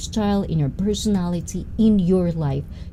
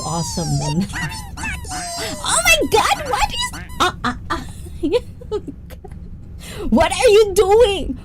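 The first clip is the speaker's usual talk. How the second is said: high squeal breaking into giggles, much higher; squealing laughter. Real, not acted. Streamer shrieking with laughter at a viewer's gift.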